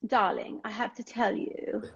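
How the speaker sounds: put-on slow, drawn-out British accent. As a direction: imitate a friend's English accent, unhurried. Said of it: "a different level of English accent".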